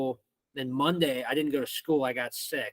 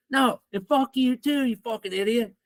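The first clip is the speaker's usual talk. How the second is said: retold fury, stretched and raised; freaking out; 9 semitones higher, 5 dB louder than usual. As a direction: imitate a kid blowing up at the principal. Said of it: "he freaked out on the".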